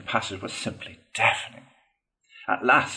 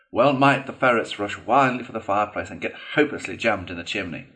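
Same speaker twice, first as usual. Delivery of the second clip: ordinary delivery, nothing marked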